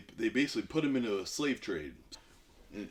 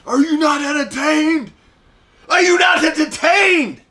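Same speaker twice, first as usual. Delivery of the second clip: huge roaring arena shout, much higher and louder; gladiator shout; 19 dB louder than usual. Retold or imitated. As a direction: bellow it to the whole crowd like a gladiator. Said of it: "and he's like".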